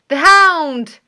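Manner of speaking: sudden loud alarmed shout, pitch jumping up. A narrator's reading. Holmes hears the hound on the moor, loud shout. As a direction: cry out the warning across the moor. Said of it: "shouted Holmes".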